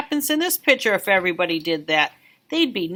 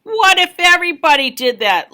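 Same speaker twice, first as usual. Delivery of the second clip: higher, louder scolding; exasperated babysitter; 7 semitones higher, 7 dB louder than usual. Acted. Babysitter scolding the kid, higher and exasperated. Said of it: "she shooed me into the bathroom".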